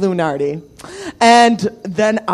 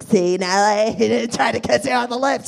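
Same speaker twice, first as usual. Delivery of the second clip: Casey's crack voice; 3 semitones higher than usual. Retold or imitated. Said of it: "I hear Casey's crack voice".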